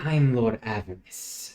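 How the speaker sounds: slow snake lord with hissed S; slow, sibilant reptilian introduction; hissing snake person. Acted. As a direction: draw out the S like a snake. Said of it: "he kinda has a hiss 'cause he's a snake person".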